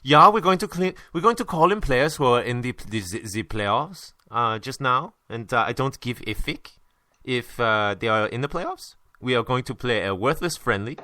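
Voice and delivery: a lightly put-on German coach accent, wandering off; mock German accent that slips toward French; hammed-up German manager voice; Klinsmann impersonation. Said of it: "For my Klinsmann impersonation".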